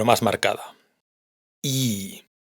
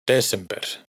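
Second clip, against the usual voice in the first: Desember with the stress on the first syllable, DE-sember; Icelandic stress demo; slower than usual. Shown as a demonstration. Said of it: "siempre es la primera". (Desember con el acento en la primera sílaba, DE-sember)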